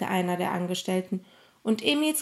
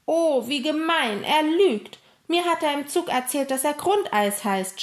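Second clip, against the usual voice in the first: high, indignant outburst; angry shout; 6 semitones higher, 4 dB louder than usual. A narrator's reading. Emil denouncing the thief's lie, indignant and angry. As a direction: protest hotly, easing a little towards the end. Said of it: "schrie Emil wütend".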